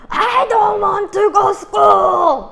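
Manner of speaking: put-on high, very loud, drawn-out child's protest shout. Mom imitating her daughter shouting on waking. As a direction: shout it like a defiant little girl. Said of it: "이렇게 얘기를 하는 거예요".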